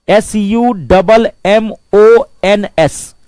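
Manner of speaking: slow, each letter of S U double M O N S separate; spelled out slowly. Shown as a demonstration. Teacher spelling summons slowly for the class. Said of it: "summons".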